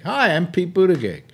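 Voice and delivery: half-hearted politician impression; Buttigieg. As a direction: pitch it up for a mock self-introduction. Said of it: "Do an impression of Buttigieg".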